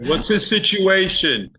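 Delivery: very high, slow put-on voice; mock fan. A clueless fan quizzing an actor about Coda, high slow put-on voice.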